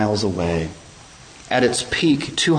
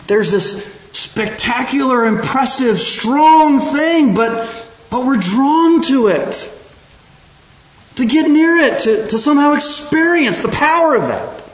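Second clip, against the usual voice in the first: much higher and louder, swept away with wonder; awed excitement; 14 semitones higher, 7 dB louder than usual. Real, not acted. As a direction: let the awe of the falls lift your voice. Preacher in awe of Niagara.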